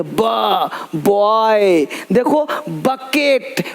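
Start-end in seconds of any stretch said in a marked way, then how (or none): 0.0-1.8 low "ब" as buh, then "बॉय"; B sound, then word. Shown as a demonstration. Teacher linking sound to word, separate low "ब" then "बॉय". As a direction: sound out "ब", pause, blend into "बॉय". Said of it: "यानी ब sound है".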